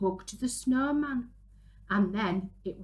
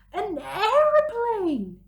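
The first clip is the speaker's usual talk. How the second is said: high, loud and slow, thrilled; excited cry; 11 semitones higher, 7 dB louder than usual. Acted. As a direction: announce the find with delight. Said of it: "cried Billy".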